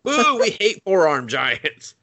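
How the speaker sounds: high, slow jeering boo; mock heckle. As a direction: heckle them like a rival crowd. Mock heckling in acted quote.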